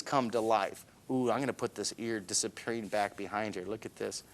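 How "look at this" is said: ordinary talk, nothing marked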